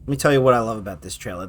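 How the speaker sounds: ordinary delivery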